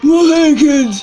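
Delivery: lightly voiced old man, loud; elderly voice. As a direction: try a shaky grandpa voice. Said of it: "That's not your elderly voice".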